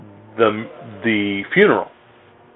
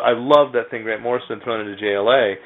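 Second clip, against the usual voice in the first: ordinary talk, nothing marked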